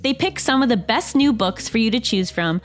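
upbeat sponsor read, clear and even. Acted. Host reads a book club ad to listeners, upbeat ad read. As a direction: sell the book club like a friend.

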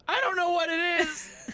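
rising to a high yelled cry; a freaked-out boy shouting about hair; panicked kid shout. Acted. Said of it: "That's my middle school voice".

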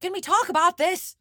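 high mock Irish accent, earnest plea. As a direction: plead in a put-on Irish voice.

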